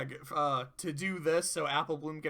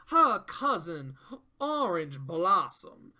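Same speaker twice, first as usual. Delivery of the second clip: slow, loud, hammed-up Southern accent; imitating an over-the-top Southern drawl, slowly; mock Southern drawl; 5 dB louder, slower than usual. Big Mac in drag playing the cousin, hammed-up Southern drawl. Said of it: "throws on this very over-the-top Southern drawl".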